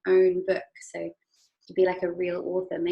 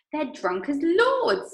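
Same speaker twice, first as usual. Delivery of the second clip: slow, high and louder; amused Wonka; 8 semitones higher, 5 dB louder, slower than usual. Acted. Mister Wonka telling the children, slow and high. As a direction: savour the joke slowly. Wonka describes tipsy Oompa Loompas.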